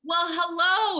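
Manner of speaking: loud, high happy hello; excited greeting. Real, not acted. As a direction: sing out a cheerful hello. Greets surprised fan warmly.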